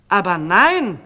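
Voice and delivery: calling out. A narrator's reading. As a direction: cut him off loudly. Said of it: "rief die falsche Königin".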